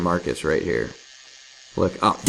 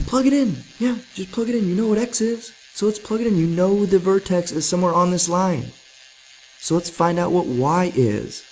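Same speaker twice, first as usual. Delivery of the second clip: excited; 10 semitones higher than usual. Real, not acted. Still high after excitement.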